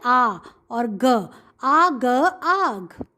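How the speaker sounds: drawn-out letters blended into a word; teacher sounding out a word for children; slow blending demo. Shown as a demonstration. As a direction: sound out each letter slowly, then join. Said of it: "इसको ऐसे पढ़ेंगे".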